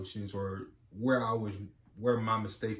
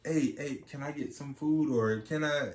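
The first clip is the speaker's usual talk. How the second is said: lightly voiced eager kid, higher; pestering child; 5 semitones higher, 5 dB louder than usual. Child interrupting parents for food and play, pestering. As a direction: mimic a child asking over and over. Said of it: "at them when he's like".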